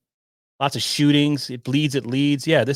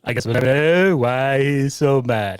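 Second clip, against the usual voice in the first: mock news anchor. Repeats reporter's closing line.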